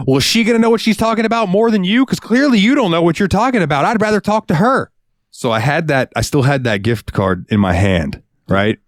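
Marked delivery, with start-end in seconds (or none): 0.1-4.9 high sneering falsetto; mock customer voice. Cashier mimics a snooty customer insulting him in a high sneer. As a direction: belittle him like a snooty shopper.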